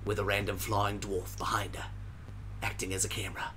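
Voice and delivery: hushed wildlife narrator; hushed, like stalking animals on film; nature documentary whisper. Acted.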